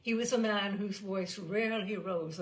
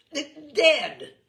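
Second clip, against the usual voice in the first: forced out, stuck then loud; stammering; 8 semitones higher, 15 dB louder than usual. A narrator's reading. Stammering umpire forces out his verdict, loud. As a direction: fight to get the word out. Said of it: "the umpire managed at length".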